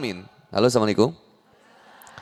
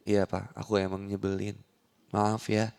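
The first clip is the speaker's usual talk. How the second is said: soft, meek and submissive apology; 9 semitones lower, 9 dB quieter than usual. Retold or imitated. Wife meekly apologizing to her angry husband, soft and submissive. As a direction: give in gently to an angry husband.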